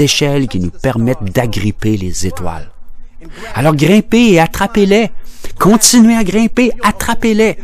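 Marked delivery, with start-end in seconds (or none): 3.5-7.6 high-pitched, ringing rallying cry; impassioned exhortation. Acted. Educator urging listeners to climb to the stars, fervent cry. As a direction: call out to urge them upward.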